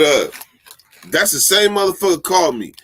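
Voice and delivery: ordinary talk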